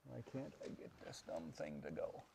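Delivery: very soft, frustrated aside; muttering to self. Real, not acted. Teacher struggling with dumb equipment, real quiet muttering. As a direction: grumble under your breath at a gadget.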